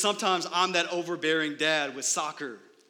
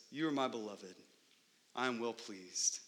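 tender divine voice; 5 semitones lower, 8 dB quieter than usual. Acted. Voicing God the Father's blessing.

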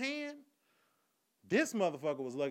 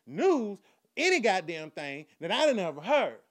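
ordinary talk

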